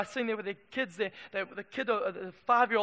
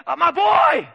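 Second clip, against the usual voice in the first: high, shouted wail of loss; grieving parent's cry; 9 semitones higher, 15 dB louder, slower than usual. Acted. Egyptian parents as their firstborn dies, grief-stricken cry. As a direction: cry out loudly for a dead child. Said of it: "parents going".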